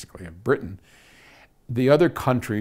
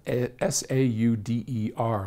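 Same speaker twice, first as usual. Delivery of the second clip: usual speaking voice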